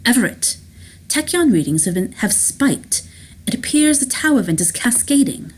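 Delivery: urgent phone report, pitch climbing; anxiety under composure. A narrator's reading. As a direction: try to stay calm while worry leaks through. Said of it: "tries to remain calm, but cannot mask its anxiety".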